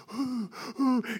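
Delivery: imitated bully huffing, high and breathy; mock fired-up breaths. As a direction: huff like someone psyching up for a fight. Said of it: "he's getting all fired up".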